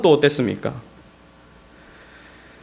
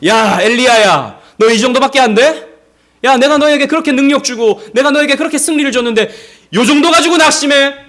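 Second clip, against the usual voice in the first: loud, high-pitched exasperated telling-off; mock scolding; 9 semitones higher, 6 dB louder than usual. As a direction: berate a disappointing servant, acted out. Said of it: "하나님 그렇게 하시지 않으셔요".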